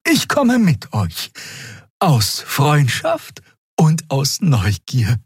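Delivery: put-on high, very slow old voice; resolute elder. A narrator's reading. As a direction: draw each word out in a high old voice. (bedächtige, hohe Altersstimme)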